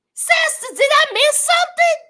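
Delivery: incredulous question; baffled. Real, not acted. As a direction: ask sister in disbelief. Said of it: "baffled at a friend".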